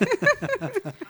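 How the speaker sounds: high, loud laugh; real burst of laughter. Real, not acted. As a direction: laugh out at the joke. (carcajada alta y fuerte)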